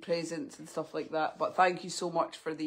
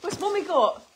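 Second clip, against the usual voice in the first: dog talk; 9 semitones higher, 6 dB louder than usual. Real, not acted. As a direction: coo excitedly at the dogs. Talking to her dogs.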